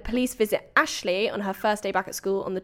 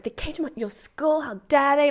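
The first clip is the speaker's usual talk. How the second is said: higher-pitched affronted mother; mildly scandalised questioning; mock indignation; 5 semitones higher than usual. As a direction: play an outraged mum, lightly. Acted mother indignant on phone.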